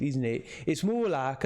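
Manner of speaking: ordinary delivery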